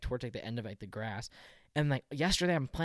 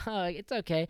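slightly amused imitation, pitched higher; lightly laughing mom; 7 semitones higher, faster than usual. Mom to the dog's owner, mock breezy reassurance. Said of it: "kinda laughing".

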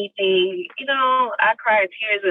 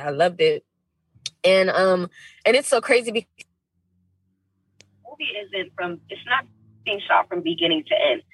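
ordinary talk, nothing marked

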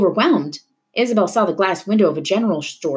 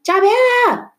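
much higher, loud yell; 5 dB louder, slower than usual. A narrator's reading. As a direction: yell at her from the car. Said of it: "to yell at her".

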